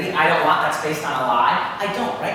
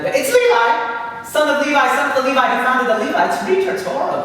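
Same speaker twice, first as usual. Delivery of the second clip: indignant Satan; 7 semitones higher, 5 dB louder than usual. Retold or imitated. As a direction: insist on Levi like an offended devil. Satan corrects Levy's name.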